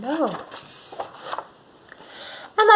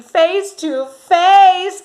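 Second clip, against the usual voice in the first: slow, high and louder; dread; 9 semitones higher, 5 dB louder, much slower than usual. Acted. Telling children the scary climax, slow frightened build. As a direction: stretch it out in mounting fright.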